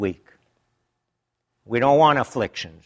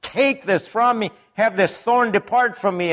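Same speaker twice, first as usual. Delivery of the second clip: higher, pleading please; begging; 8 semitones higher than usual. Retold or imitated. Paul begging God to take his thorn away. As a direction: plead with God like a desperate man. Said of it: "begging God three times".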